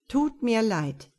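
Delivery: flat repeated line, slow; monotone. A narrator's reading. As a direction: drone the same phrase slowly, unmoved. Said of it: "wiederholte er monoton". (monoton und langsam wiederholt)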